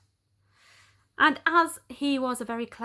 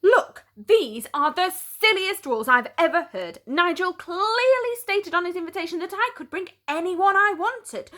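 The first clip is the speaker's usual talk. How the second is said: rising high, loud rant; frustrated yelling at the doorman; exasperated outburst; 8 semitones higher, 5 dB louder than usual. Acted. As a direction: finally snap at the silly rules. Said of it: "that was when I broke".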